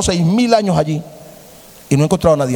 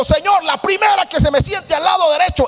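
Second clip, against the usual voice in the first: eager shouted prayer; 8 semitones higher than usual. Acted. Young man at church shouting his prayer for a wife. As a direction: call it out to God in a hurry.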